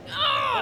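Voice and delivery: loud high retold yelp of pain; pain scream. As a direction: yelp as if you just broke your foot. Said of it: "and I was like".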